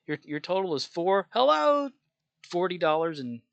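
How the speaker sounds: high, loud hello mid-total; sudden loud greeting. Shown as a demonstration. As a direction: blurt hello mid-sentence. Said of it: "You know, like that".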